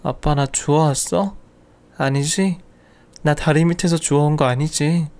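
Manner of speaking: put-on slow, small tearful child's voice. Daughter in father's arms asks if adopted, tearful and slow. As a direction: ask as a frightened little girl near tears. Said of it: "울먹이며 말을 하더라고요".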